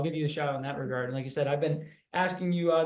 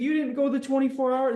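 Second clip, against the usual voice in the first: higher, pleading mock wife voice; lightly voiced tearful wife, pitched up; imitating upset wife; 10 semitones higher, 4 dB louder than usual. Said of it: "She's like".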